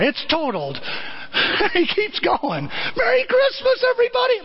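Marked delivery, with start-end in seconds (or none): 3.0-4.4 very high, near-tearful shout; ecstatic cry. Retold or imitated. George greeting the town, high ecstatic cry. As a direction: cry it out with joy.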